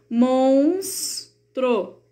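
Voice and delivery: "monstro" said very slowly as "mons-tro", the two syllables pulled apart; syllables split slowly. Shown as a demonstration. Teacher splitting a word into syllables for pupils, very slow. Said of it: "Como vamos separar?".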